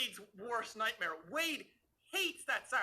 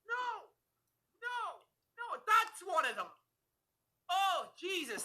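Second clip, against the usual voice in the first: shouting in disgust; 5 semitones higher than usual. Real, not acted. Hearing the worst sound.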